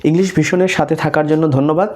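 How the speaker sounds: ordinary delivery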